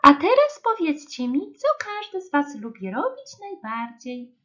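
high, lively teacher question; singsong nursery teacher addressing children; pani Łosia. A narrator's reading. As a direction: ask the class brightly, starting loud and high. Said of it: "Zapytała pani Łosia".

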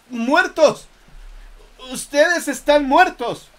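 high, shrieking horror; terrified scream. Acted. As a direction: scream in sheer terror. Man realizes soldiers are dead. (grito de terror agudo)

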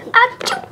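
high mock sneeze; hammed-up sneezing; fake sneeze.

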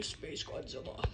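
breathy whispered name. Acted. Character whispers his partner's name, hushed whisper. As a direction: whisper it like a secret.

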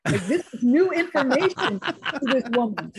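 loud, high, laughing aside; amused, laughing. Real, not acted. Scholar telling of the woman's surprise, laughing. Amused at her own anecdote.

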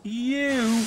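high, drawn-out scornful exclamation; scornful snort. A narrator's reading. Henry scoffing at boastful James, snorting. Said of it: "snorted Henry".

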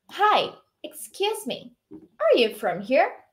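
slow, clearly intoned model for repeating; model dialogue reading. Shown as a demonstration. Teacher modelling a dialogue for students to repeat, slow model reading. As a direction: read it slowly for learners to copy. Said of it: "tenta imitar a minha entonação".

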